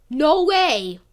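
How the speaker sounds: high, loud cry of amazement; thrilled disbelief at a find; amazed. Real, not acted. Finds an item in game.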